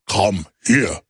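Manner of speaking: low slow Dracula, mocking a critic; Count Dracula impression. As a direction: lure him in like a vampire count. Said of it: "Like Count Dracula to Von Helsing".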